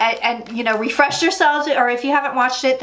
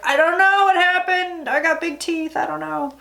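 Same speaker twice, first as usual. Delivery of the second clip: bad Giuliani impression; 5 semitones higher than usual. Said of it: "That's my god-awful Giuliani impression".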